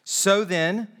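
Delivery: projected, deliberate pulpit reading; slow scripture reading. Real, not acted. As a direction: read it out slowly to the whole church. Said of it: "I want to read these slowly".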